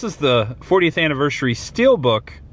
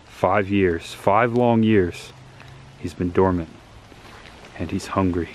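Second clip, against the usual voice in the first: ominous narration; 4 semitones lower, 4 dB quieter than usual. Acted. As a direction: set a creepy campfire mood. Spooky horror intro narration.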